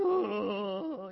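loud drawn-out groan; mock moaning. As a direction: moan like a man in mourning, hammed-up. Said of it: "He's moaning and groaning".

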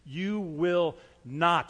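"You will not" slow, leaning hard on "not"; emphatic NOT. Shown as a demonstration. Preacher insisting on the promise to his congregation, emphatic stress on "not". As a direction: stretch out "You will not," landing heavy on "not". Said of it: "very emphatic".

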